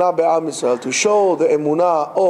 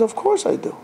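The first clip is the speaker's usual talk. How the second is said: eager assurance; 3 dB quieter than usual. Retold or imitated. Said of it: "He says".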